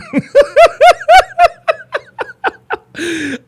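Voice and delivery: long high-pitched wheezing laugh; helpless, drawn-out high laugh; laughing hard. Real, not acted. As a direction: crack up into a squealing cackle. Cracks up at own texting anecdote.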